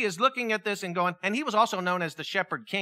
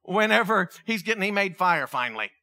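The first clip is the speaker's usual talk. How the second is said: ordinary delivery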